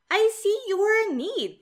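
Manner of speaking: put-on a cheerful, relaxed young cat who isn't scared at all; a hint of a breezy kid cat; David the cool cat. David answers the vampire.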